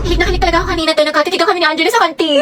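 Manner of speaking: normal talking voice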